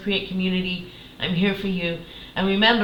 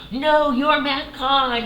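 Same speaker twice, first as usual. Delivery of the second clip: high, drawn-out mock gushing; silly starstruck disbelief; 8 semitones higher, 4 dB louder, much slower than usual. Fan meeting a favorite spiritual teacher, silly starstruck gushing. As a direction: stretch it out like an overwhelmed fan. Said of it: "it was so silly".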